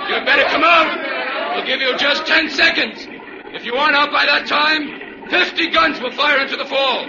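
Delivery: shouting a ten-second warning at the hidden killer; shouted ultimatum. Acted. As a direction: hammer out the threat.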